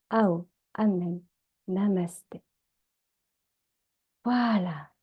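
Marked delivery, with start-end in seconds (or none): none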